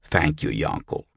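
low, understated dry thanks. A narrator's reading. Brother Albert to the reluctant boy, dryly. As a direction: thank the child with deadpan irony. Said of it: "Albert said dryly".

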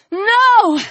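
high, drawn-out cry of protest; anguished cry. A narrator's reading. Alex sees Chris clubbed down, high anguished cry. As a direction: cry out in horror, pitch leaping up. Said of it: "Alex cried".

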